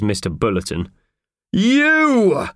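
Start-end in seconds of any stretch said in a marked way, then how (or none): 1.5-2.5 loud, high roar of recognition; angry roar. A narrator's reading. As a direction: roar it out at the culprit. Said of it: "he roared".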